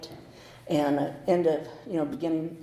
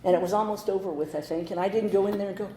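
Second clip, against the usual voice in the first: ordinary talk, nothing marked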